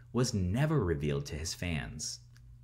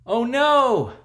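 high, loud "oh no"; alarmed cry; 10 dB louder than usual. Acted. Reader reacting to the next wrestler, high alarmed cry. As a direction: gasp it out in mock alarm. Narrator reacts to sister waking.

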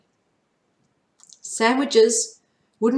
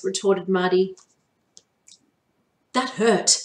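ordinary delivery, nothing marked

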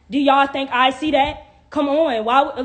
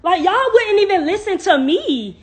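loud, high-pitched protest; exasperated complaint; 7 semitones higher, 6 dB louder than usual. Real, not acted. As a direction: protest loudly at being ignored. Complains viewers will not listen.

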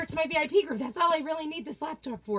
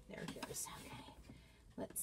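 whispering; 8 semitones lower, 23 dB quieter, faster than usual. Real, not acted. Muted whisper while going live.

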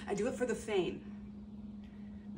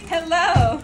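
loud, high hello; called-out greeting; 9 semitones higher, 14 dB louder than usual. Real, not acted. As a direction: call out brightly to someone at a distance. Calling back a greeting to passer-by.

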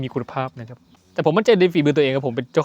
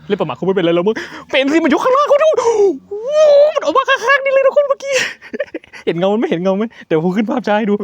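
much higher and louder frightened yelling; panicked shouting; 7 dB louder than usual. Real, not acted. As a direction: scream it out in real panic. Panics as ghost appears.

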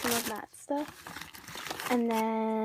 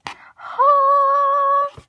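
excited squeal; 13 dB louder than usual. Real, not acted. Squeal at eyelashes.

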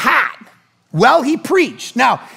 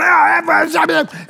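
imitated swearing; 7 semitones higher, slower than usual. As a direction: blurt the swear syllables like the angry worker. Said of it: "The guy's going".

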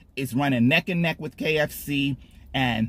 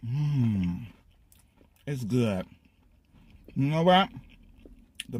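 talking between chews; chewing while talking; 2 semitones lower, slower than usual. Real, not acted. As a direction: mouth partly full. Seen 3: Bite at -7, chewing continues; closed, working mouth through 3.3.

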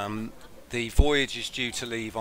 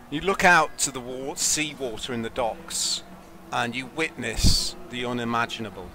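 dramatic narration; 3 semitones higher, 5 dB louder than usual. Acted. GM narrates the ships rising.

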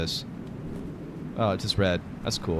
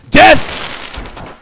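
high, loud shouted war cry; battle cry; 9 semitones higher, 6 dB louder than usual. Real, not acted. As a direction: yell it like charging into battle. Gamer yells battle cry.

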